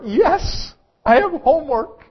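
put-on high, loud, eager child's cheer; excited kindergartner. Kindergartner asked by parent about homework, excited. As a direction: belt it out proudly, way up high. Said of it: "They are so excited about homework".

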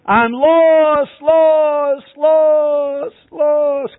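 high, loud cry for help from the cave; shrill repeated cry into the dark; desperate scream. Acted. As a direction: yell it out, lost and panicking. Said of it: "You scream".

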